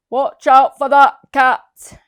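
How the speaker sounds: loud warning shout. Acted. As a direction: yell a warning across the room. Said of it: "shouted the vet".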